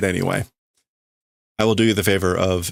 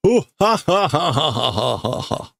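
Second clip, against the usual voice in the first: villain laugh; 6 semitones higher than usual. Acted. Reading the manga, voicing Grimmjow's laugh, a high cackle fading out. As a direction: cackle like the manga villain, then let it die away.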